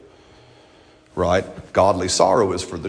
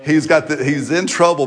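normal talking voice

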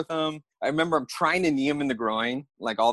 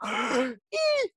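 squeak; 11 semitones higher than usual. Retold or imitated. Startled drunk caught out, gasping squeak. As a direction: gasp then tiny high squeak. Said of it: "It's a little squeak".

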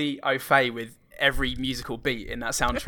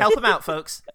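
rallying the crowd; 6 semitones higher, 3 dB louder than usual. Pretend. Repeats louder to audience.

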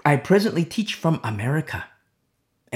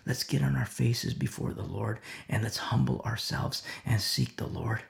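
humble call; 5 semitones lower, 5 dB quieter than usual. Retold or imitated. A humble believer calling the church to repent, softer. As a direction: plead gently for repentance. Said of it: "who says".

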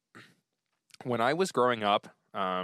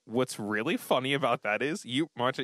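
usual speaking voice

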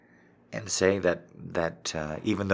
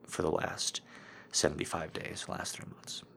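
quiet; 10 dB quieter than usual. Real, not acted. Confessing struggle, voice drops away.

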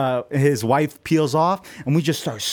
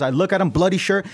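ordinary delivery

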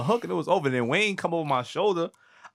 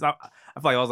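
ordinary talk, nothing marked

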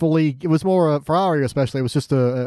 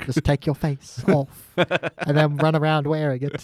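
creepy Arya; slower than usual. Retold or imitated.

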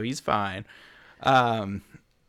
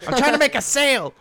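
high, loud snapping gnome; angry gnome shout; 11 semitones higher, 7 dB louder than usual. Acted. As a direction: yell at the machine as a furious gnome. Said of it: "The gnome takes a wrench and throws it".

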